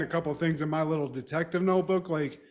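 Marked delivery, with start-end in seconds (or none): none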